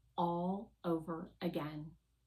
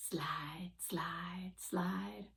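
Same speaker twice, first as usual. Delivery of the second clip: sliding whisper; 7 dB quieter, much slower than usual. A narrator's reading. Wheels gliding over soft sand.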